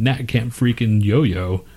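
ordinary talk, nothing marked

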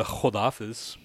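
Khodafis with a throaty kh at the start; Khodafis, guttural kh then hodafis; Farsi word. Shown as a demonstration. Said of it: "You can't say one word?".

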